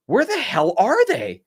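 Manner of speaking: alarmed disbelief. Retold or imitated. Narrator looking around for his missing bandmates, high and alarmed. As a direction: let the worry break into disbelief.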